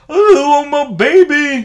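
high wailing falsetto plea; [name] crying impression. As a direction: wail it high like a sobbing man. Mocking [name]'s crying video.

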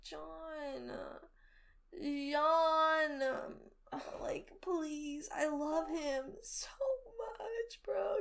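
soft, pleading, near tears; whispered half-sob plea; pleading with John. Retold or imitated. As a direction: beg him gently, voice catching. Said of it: "I was like".

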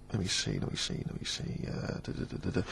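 mumbled to himself. Real, not acted. Mumbling while reading questions.

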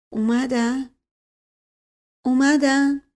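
frail, trembling question, rising on the repeat. A narrator's reading. Sick uncle asks if they have come, trembling. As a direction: ask anxiously, voice trembling. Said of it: "با صدای لرزانی پرسید".

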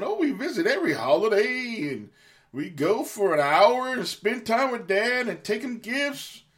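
high, smug mock of proud visitors; smug self-praise. Mocking children who boast of holiday visits, smug imitation. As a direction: brag sweetly about the hourly visit. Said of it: "what a lot of you motherfuckers are always saying".

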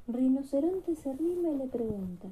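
plain reading voice

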